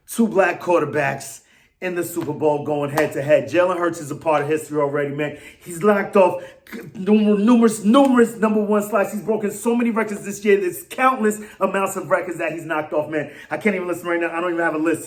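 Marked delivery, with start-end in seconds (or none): none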